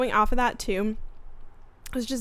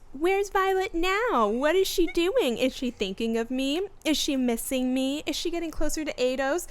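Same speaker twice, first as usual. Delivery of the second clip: mocking voice; 4 semitones higher than usual. Sigale mocking Xaden about Violet, high mocking singsong. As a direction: needle him in a taunting lilt. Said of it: "a little mocking voice".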